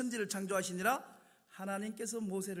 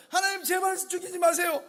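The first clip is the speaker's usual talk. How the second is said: high, loud desperate shout; shouted plea; 9 semitones higher, 11 dB louder than usual. Acted. Moses shouting to God to spare the people, desperate. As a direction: shout the plea at full force.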